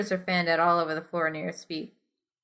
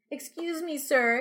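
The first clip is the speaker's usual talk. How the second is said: polite; 8 semitones higher, 4 dB quieter, slower than usual. A narrator's reading. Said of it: "you say politely".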